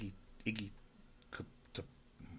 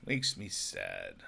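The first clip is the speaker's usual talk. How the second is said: a much lower, slow, mopey mock-sad voice; 13 semitones lower, slower than usual. Shown as a demonstration. Repeating his complaint about voices, in a deep mock-sad tone. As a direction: drag it out low like a pouty kid.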